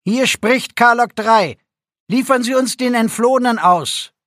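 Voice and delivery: tinny radio voice over the speakers. A narrator's reading. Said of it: "Wieder erklang die blecherne Stimme".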